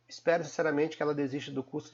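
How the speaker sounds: fast and unbroken, no pause anywhere; run together wrong. Shown as a demonstration. Teacher demoing the wrong reading, fast and unbroken. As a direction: rush the sentence through in one breath. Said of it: "Como você vai pronunciar desse jeito?".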